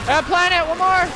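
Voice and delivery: high, loud excited callout; pumped-up game callout; real hype. Real, not acted. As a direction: cheer the teammate on. Hyped callout during the match.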